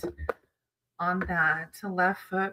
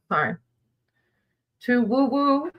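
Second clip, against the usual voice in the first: ordinary delivery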